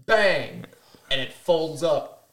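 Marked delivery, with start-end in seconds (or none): 0.1-0.6 loud, punchy mock bang; imitated gunshot. Hunter retelling the shot at the bird, imitated gunshot. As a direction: fire off the word like a shot.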